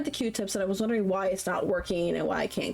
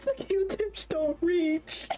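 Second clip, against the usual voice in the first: high, slow whining sob; mock crying; 10 semitones higher, much slower than usual. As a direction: drag it out like a weeping kid. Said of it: "like, crying".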